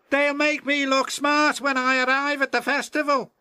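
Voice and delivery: high, fast, self-important engine voice; a show-off brushing off his friends; vain James. Acted. James refusing to help his friends, high vain voice.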